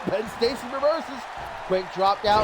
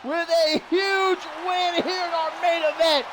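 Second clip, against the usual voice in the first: excited shout; 7 semitones higher, slower than usual. Real, not acted. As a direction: build to the win and hold the call. Finishing move, match won.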